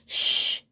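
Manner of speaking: long, voiceless shh; hushing shush. A narrator's reading. Unicorn to the moon about the mirrors, hushing. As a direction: shush to keep a secret.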